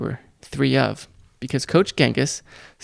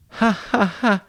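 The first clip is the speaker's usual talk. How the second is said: forced high laugh, queasy; faked "ha ha ha"; 8 semitones higher, slower than usual. A narrator's reading. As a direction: fake it though it hurts. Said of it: "faking all this laughter".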